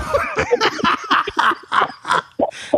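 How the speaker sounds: high-pitched helpless laughter; real laughing fit. Real, not acted. Friend recalling his panicked face, laughing hard. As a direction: crack up at the memory.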